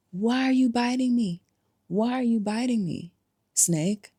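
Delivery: a touch of indignant disbelief; mock hurt surprise. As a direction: act betrayed and baffled. Victim asks the snake.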